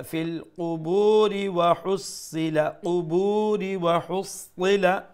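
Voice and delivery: কুবুরি joined on to ওয়া হুসিলা, moderate long u; measured chant linking কুবুরি into the next word; continuous recitation. Shown as a demonstration. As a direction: recite straight through without stopping at কুবুর. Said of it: "আর যদি continue করতে চাই".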